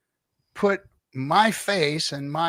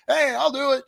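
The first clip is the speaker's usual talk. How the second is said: put-on much higher, perky girl voice; girl volunteering; 12 semitones higher than usual. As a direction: pitch way up, eager to jump in.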